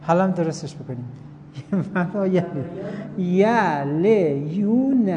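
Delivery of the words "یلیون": drawn out syllable by syllable, x0.3; slowly sounded out. Shown as a demonstration. Teacher sounding out the word for a student, slowly drawn out. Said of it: "ایشون میگه اینجوری بنویسیدش".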